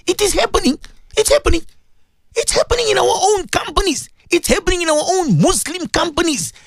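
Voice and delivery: high-pitched, emphatic repetition; impassioned insistence. Real, not acted. Insists angrily on the injustice.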